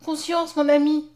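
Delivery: high, eager exclamation; lively reply. A narrator's reading. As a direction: answer quickly and warmly, voice lifted. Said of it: "répondit vivement la jeune fille". (réponse vive, voix haute)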